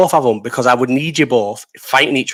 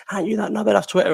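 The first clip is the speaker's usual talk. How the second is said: mock taunt; 8 semitones higher, 4 dB quieter, faster than usual. Imitating people who recognise him.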